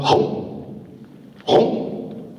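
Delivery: breathy voiceless gulp sound; hollow, whispered chomps; snake gulping. Acted. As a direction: imitate a snake gulping food. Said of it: "the snake go out".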